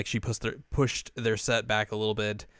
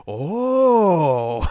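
loud, high drawn-out "oh"; hammed-up moment of revelation; mock awe; 8 semitones higher, 8 dB louder than usual. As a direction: let it swoop high, then fall. Character's awed reaction, retold.

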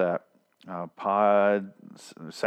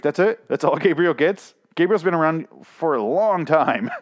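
retold indignant disbelief; 7 semitones higher, 5 dB louder than usual. Retold reaction to Gabriel's death.

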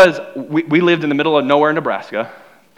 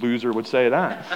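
much lower, softer mock cover-up; sheepish backpedal; 9 semitones lower, 7 dB quieter than usual. Kid quickly disowning his word pop, low sheepish mumble. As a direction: mumble it like hiding embarrassment. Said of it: "I was like".

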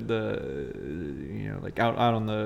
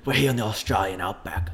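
gravelly Australian accent. Shown as a demonstration. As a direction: narrate like a gravelly Australian nature host. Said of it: "I go the gravelly route".

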